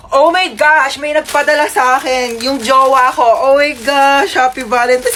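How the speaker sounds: high, loud gushing over a gift; giddy, lovestruck. Acted. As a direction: squeal as if thrilled by your sweetheart. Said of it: "Kinikilig daw ako sa jowa ko". (kilig na kilig)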